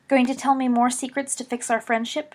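a touch of tartness, lightly sarcastic; mildly biting question; tart. A narrator's reading. Lily teasing Remus about his secrets, lightly tart. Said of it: "she asked tartly".

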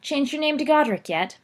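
high mocking jab; sneering. Rosier taunting Sirius about Gryffindor, sneering. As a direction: needle him with a sneer. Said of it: "sneered Evan Rosier".

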